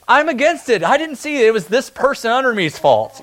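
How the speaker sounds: whiny blame-shifting; blame-shifting politician. Retold or imitated. Politician blaming his staff, mock whiny.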